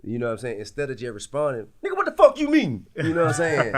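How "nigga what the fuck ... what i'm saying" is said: loud, high angry retort; angry shout. Retold or imitated. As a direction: snap back like you're ready to fight. Hot-headed reply, acted quote.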